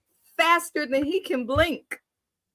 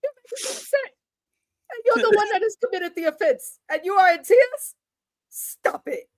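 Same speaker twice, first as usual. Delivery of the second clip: imitating the stern uncle; 7 semitones higher than usual. Uncle scolds the crying wife, high mock reproach. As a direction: scold her like a fed-up uncle. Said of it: "he looked at her and said".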